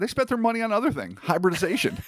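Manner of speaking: joking. Pretend. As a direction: rattle off the gag. Joke about alien budgets.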